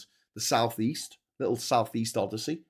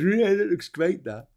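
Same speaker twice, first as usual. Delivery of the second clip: high, slow mock-admiring voice; put-on pleased voice, drawn out and pitched up; Donald Trump; 6 semitones higher than usual. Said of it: "looks out the window at the rubble and goes".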